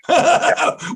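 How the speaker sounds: sudden high laugh; real laugh bursting out high; high burst of laughter. Real, not acted. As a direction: break into a high laugh at the joke. Laughing at his own joke.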